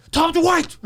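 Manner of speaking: shout from the toilet. Retold or imitated.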